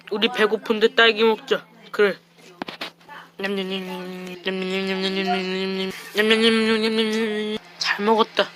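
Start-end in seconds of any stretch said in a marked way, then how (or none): 3.4-7.6 soft lip-smacking munching; quiet playful chomping sounds; eating noises. Pretend. Eating strawberries, mock eating noise. (냠냠 먹는 소리)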